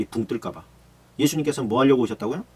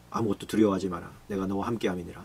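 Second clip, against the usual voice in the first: lower, much softer comfort; soft reassurance; 6 semitones lower, 6 dB quieter than usual. Acted. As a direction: say it gently, almost hushed. (두려움에 빠진 이들에게 예수님이 낮고 부드럽게 건네는 말)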